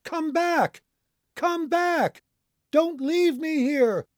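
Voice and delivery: very high, stretched-out scream; desperate screaming. A narrator's reading. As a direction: scream to be heard across the water. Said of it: "I screamed".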